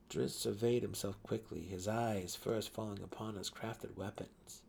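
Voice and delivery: ordinary delivery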